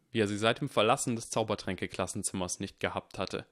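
usual speaking voice

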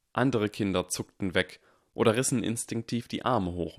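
ordinary delivery

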